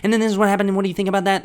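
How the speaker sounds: loud, high, rapid-fire babble; frantic therapy talk. Shown as a demonstration. Client to therapist with only an hour, frantic rapid talk. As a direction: race through it at a high, loud pitch. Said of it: "This is how I talk in my therapy".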